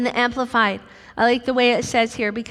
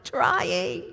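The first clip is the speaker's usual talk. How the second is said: mock whine; 4 semitones higher, much slower than usual. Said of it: "usually is my answer".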